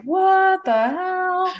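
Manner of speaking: high, slow, stretched out what the hell; high stunned what the hell; shocked disbelief. Retold or imitated. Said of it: "And I was like".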